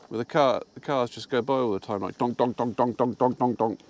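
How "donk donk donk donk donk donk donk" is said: usual speaking voice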